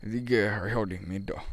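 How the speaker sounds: slurred, mumbled imitation; mock an old man's garbled grumble; unintelligible grumbling. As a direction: run the words into a mush. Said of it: "I didn't know what he was saying".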